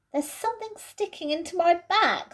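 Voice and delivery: Peter's voice. Acted. Peter grumbles in the cramped wardrobe, uncomfortable. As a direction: squirm as you say it.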